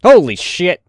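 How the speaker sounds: real shock, loud and high; sudden loud swear of surprise; startled outburst. Real, not acted. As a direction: blurt it out as something jumps at you. Startled by something in the game.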